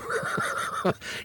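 silent wheezing laugh; breathless laugh. Real, not acted. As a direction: laugh without voice, helplessly amused. Laughing at the wife's threat.

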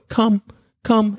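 "Come" twice, high and bright; calling come. Shown as a demonstration. Owner calling the dog away from its bowl, bright. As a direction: call "Come" to pull the dog off its food. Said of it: "and you go".

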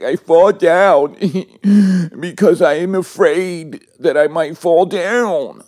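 high, loud, slow sobbing-child imitation; hammed-up little boy crying out his words; seven-year-old's voice. Crying son answering his father, mock child voice. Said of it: "in his little seven-year-old voice".

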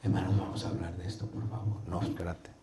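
barely audible, muttered low; too quiet. Shown as a demonstration. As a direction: start the sermon far too softly. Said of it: "ni demasiado bajo". (demasiado bajo, casi inaudible)

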